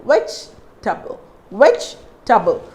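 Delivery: "Vegetable" split as vej, tuh-bul; three syllables. Shown as a demonstration. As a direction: pause after VEJ in "Vegetable", middle E silent. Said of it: "That is only three syllable".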